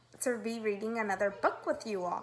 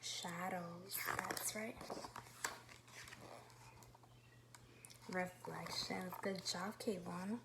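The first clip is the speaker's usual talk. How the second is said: soft, slow whispered answers; 3 semitones lower, 16 dB quieter, slower than usual. Real, not acted. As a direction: whisper the answers gently to the class. Quiet turn, children answering.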